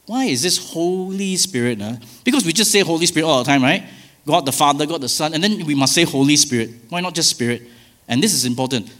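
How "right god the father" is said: ordinary talk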